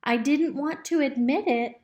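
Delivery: ordinary delivery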